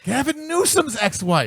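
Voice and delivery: high-pitched, astonished exclamation; scandalized, voice shooting up; incredulous outburst. Real, not acted. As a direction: blurt it out in comic disbelief. Exclaims over who she is.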